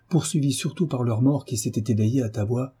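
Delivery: plain reading voice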